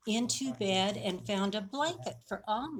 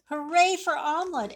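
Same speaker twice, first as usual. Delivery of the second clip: high, fast, louder hooray; cheer; 7 semitones higher, 5 dB louder, faster than usual. A narrator's reading. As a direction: cheer like a crowd. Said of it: "everyone cheered".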